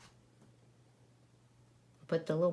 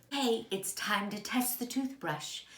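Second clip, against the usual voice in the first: ordinary delivery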